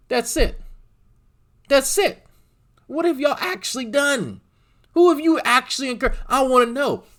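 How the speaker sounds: very high-pitched, indignant demand; exasperated challenge. Real, not acted. Streamer demanding what the hosts have done, exasperated. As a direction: push the voice way up, demanding answers.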